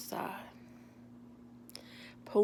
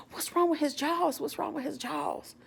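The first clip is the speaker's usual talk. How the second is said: higher-pitched mock panic; retold panic; 8 semitones higher than usual. Stranger looks at Cletus and starts panicking, mock panic. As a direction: imitate a man in a panic, pitched up. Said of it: "he starts panicking".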